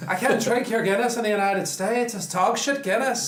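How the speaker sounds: hammed-up leprechaun Irish voice; Irish accent. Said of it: "I almost got the accent down".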